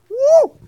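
loud high whoop; excited whoop. Retold or imitated. Teasing whoop at his wife's comeback, high and loud. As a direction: let out a big teasing whoop.

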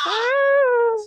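high, loud startled yelp; startled shout. Real, not acted. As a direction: jump out of your skin. Startled by sudden scare.

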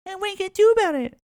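put-on bratty kids taunting the man on his lawn; mocking bratty kid. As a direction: sass back like a cocky teenager. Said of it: "they're like".

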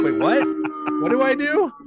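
high, loud, flustered panic; confused player. Retold or imitated. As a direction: squeak it out like a lost player. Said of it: "because you're like".

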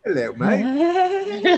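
high, slow, hammed-up British greeting; a hammed-up British accent, stretched out slow and high; bad British accent. Teasing a friend back in a bad British accent. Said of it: "my British accent sucks".

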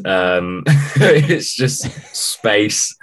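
ordinary talk

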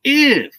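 "if." said alone, high and pointed; stressed if. Shown as a demonstration. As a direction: punch "if." high as the key word. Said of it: "I'm gonna stress this word".

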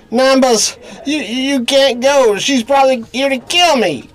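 panicked pleading. Acted. Begs bookkeeper not to leave.

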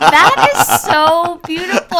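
high, loud, drawn-out gush; gushing admiration. Retold or imitated. Retelling her reaction to friend's accent, gushing.

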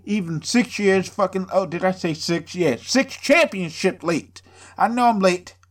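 normal talking voice

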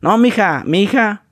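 lightly voiced norteño, mija drawn out; a touch of a northern Mexican rancher; northern Mexican accent. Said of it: "Así de que". (un toque de acento norteño)

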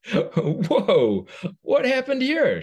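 high, loud surprised whoa; startled auntie. Acted. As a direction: blurt out your shock. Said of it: "her teeth chattering".

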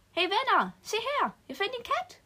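high, loud and thrilled; excited call. Acted. Girl shows her friends a found cat, high excited call. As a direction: call the friends over, delighted.

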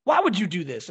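loud, imitated protest; upset aunt. Aunt learns by phone he is leaving, upset. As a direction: challenge him in a mock dismayed voice. Unhappy aunt hears the news.

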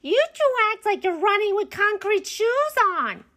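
high-pitched character yell. A narrator's reading. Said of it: "he'd yell".

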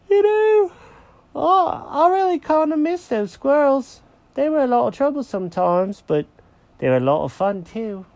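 high-pitched cartoon cat, missing old friends; high, wistful character voice; wistful Jenkins the cat. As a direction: voice a small cat reminiscing, pitched well up. Jenkins's voice in story.

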